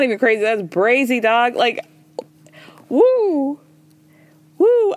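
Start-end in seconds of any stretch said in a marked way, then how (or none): 2.9-4.9 high-pitched "woo", louder the second time; high whoop. Real, not acted. Podcaster reacting to a wild episode, high whooping. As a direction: let out two high, drawn woos.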